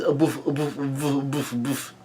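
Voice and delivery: soft, puffed impact noises; beating noises. A narrator's reading. Prometheus takes a major beating, imitated in soft puffs. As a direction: puff out each hit quietly. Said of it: "takes a major beating".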